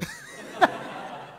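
breathy laugh; real laughter. Real, not acted. Speaker laughing at the blind man's directions. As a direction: laugh at the irony.

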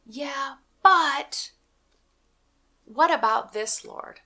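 high-pitched protesting excuse, punched on BUT; mock objection. Preacher voices a believer making excuses to the Lord, mock protesting. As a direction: imitate someone pushing back with an excuse.

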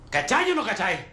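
angry insistence. Acted. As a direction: press hard for an answer. Presiona al otro para que entienda. (insistencia enojada, voz alzada)

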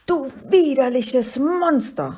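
high, loud little monster shrieking; wütender Schrei. A narrator's reading. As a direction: scream it as a furious little creature. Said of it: "schrie ihn das kleine Scheusal an". (wütend schreiendes kleines Scheusal)

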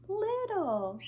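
high, small voice; little voice. Shown as a demonstration. Teacher contrasting opposites for children, in a little voice. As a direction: lift the word up high and light.

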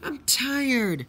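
high-pitched tired complaint; high protest. Acted. Tired Luke protests to the engines.

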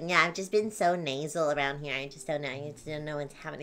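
the sprite complaining she feels nasal; mopey sprite. Acted. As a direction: moan about being stuffed up. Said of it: "sometimes she's like".

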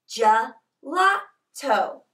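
"gelato" slowly, split into three separate syllables. Shown as a demonstration. Teacher teaching Italian word "gelato" to children, slowly syllable by syllable. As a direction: say "gelato" in three slow beats with gaps.

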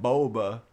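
BOBA with a long O, BOH-buh; trying the corrected pronunciation. Shown as a demonstration. Streamer testing chat's way, BOBA as BOH-buh. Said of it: "Is that how you say it?".

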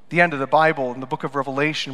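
usual speaking voice

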